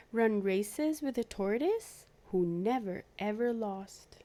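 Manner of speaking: ordinary delivery, nothing marked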